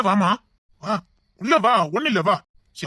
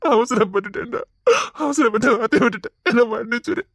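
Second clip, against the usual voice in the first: sobbing apology. Acted.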